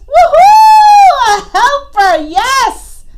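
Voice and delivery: high, loud whoop of delight; joyful cheering. Acted. Iguana cheers at finding a helper.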